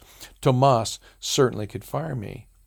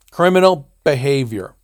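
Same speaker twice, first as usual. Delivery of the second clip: loud, angry accusation; 4 semitones higher, 9 dB louder than usual. Acted. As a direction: bark the charge at him. Martian accuses Tomas angrily.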